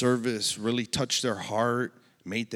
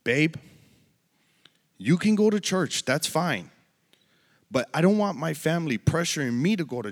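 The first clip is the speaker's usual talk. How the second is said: much higher and louder, heated protest; upset outburst; 6 semitones higher, 7 dB louder than usual. Retold or imitated. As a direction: retell her objection, raised and insistent. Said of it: "and I quote".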